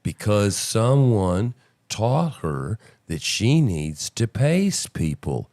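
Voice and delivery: drawn-out, slow mock delivery; slow right down to show her pacing; slow talk demo. Shown as a demonstration. Host slowly mimicking her pacing, slow and drawn out. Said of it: "she's talking like this".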